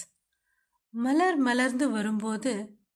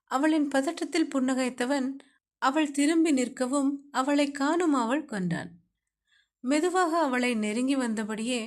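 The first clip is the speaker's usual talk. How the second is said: ordinary talk, nothing marked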